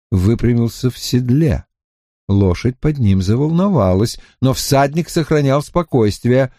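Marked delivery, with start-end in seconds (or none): none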